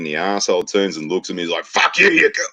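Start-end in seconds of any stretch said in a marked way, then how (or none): 1.7-2.4 high, strained mock shout; raised-pitch acted outburst; retold angry yell. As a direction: imitate an aggressor yelling back. Aggressor turns on him, retold quarrel.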